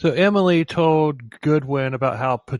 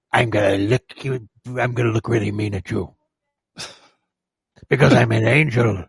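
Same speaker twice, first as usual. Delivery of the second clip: lower, mock-tough villain; mock menacing voice; 3 semitones lower, 4 dB louder than usual. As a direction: ham up a wannabe menace. Mocking Arthur's evil stare.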